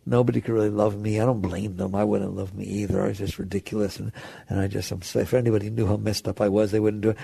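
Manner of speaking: low, soft, self-deprecating mumbling; acted hopeless self-talk, dropped low and hushed; self-pitying inner voice. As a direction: play a dejected inner monologue, quietly. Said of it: "they talk like this".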